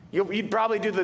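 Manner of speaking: ordinary delivery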